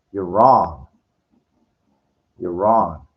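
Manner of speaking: loud, emphatic contradiction; loud retold rebuttal; firm retort. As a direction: retell a blunt comeback, punched loud. Retold dance floor argument.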